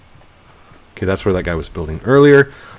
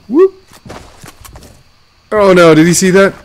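panicked gasp; 8 semitones higher, 6 dB louder than usual. Real, not acted. Spotted by enemy.

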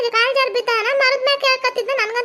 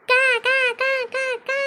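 crow cawing. Acted. Crow calling out, imitated cawing. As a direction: caw over and over like a crow.

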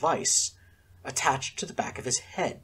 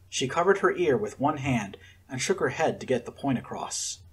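ordinary delivery, nothing marked

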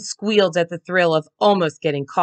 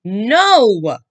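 frustrated; 5 semitones higher, 7 dB louder than usual. A narrator's reading. Mom frustrated by fake apology.

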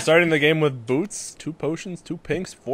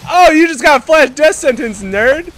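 taunting; 10 semitones higher, 9 dB louder than usual. Real, not acted. Taunting a killed enemy.

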